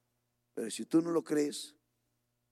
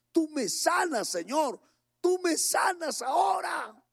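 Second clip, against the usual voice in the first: crying out to God; 9 semitones higher than usual. Retold or imitated. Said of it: "Clamaba, clamaba".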